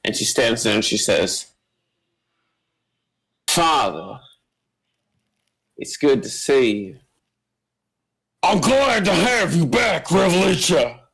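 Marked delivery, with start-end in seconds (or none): 3.5-7.0 lightly voiced, measured and fluent; composed greeting with deliberate pauses; stutter-free daughter. Daughter coolly greeting her father, lightly voiced, no stutter. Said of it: "the stutter in her voice is gone". 8.4-11.0 high, strained character voice; put-on father voice. Father welcoming his daughter back, high put-on voice.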